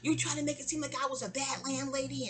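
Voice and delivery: high, wailing string of protests; mock yelling. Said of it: "just yelling and yelling and yelling".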